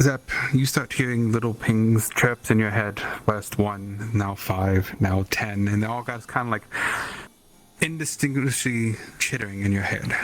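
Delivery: loud, building narration; loud excited narration. A narrator's reading. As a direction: build it up loud as the chirps multiply. Building chirping voices in head.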